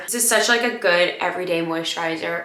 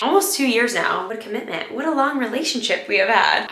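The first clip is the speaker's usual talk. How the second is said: usual speaking voice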